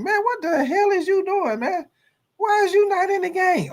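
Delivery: high-pitched exasperated coach voice; a coach who can't believe his quarterback left. Acted. Head coach confronts player.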